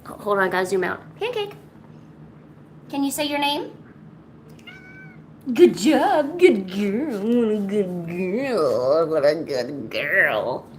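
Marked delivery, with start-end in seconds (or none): 1.2-3.7 high and hushed coaxing; soft pet talk. Real, not acted. 5.6-10.7 slow drawn-out praise to the cat; tender praise. Real, not acted.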